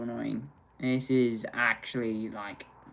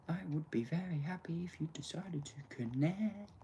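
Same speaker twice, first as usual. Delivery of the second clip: soft, slow, half-whispered begging; pleading murmur; 6 dB quieter, much slower than usual. Real, not acted. Streamer begging the internet to reconnect, soft slow pleading. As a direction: coax the router gently.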